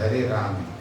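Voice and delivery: राम said simply, low-pitched; straight correct pronunciation. Shown as a demonstration. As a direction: model राम plainly, without foreign colouring. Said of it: "लेकिन हमें सीधा बोलना चाहिए". (राम का सीधा, सही उच्चारण)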